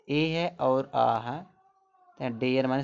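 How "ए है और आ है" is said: ए and आ each drawn out slowly; slow vowel demo. Shown as a demonstration. Teacher to class on डेयर, slow sounding out. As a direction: say ए, then आ, slowly and clearly. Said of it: "डेयर में देखो एक उच्चारण देखो".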